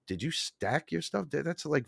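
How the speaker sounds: quietly puzzled investigator; suspicious cop. Retold or imitated. Cop questioning folded clothes.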